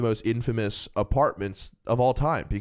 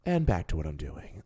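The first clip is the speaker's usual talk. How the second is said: impression of the old lady; 8 semitones lower, 6 dB quieter than usual. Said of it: "Tell me I'm not gonna nail this fucking impression".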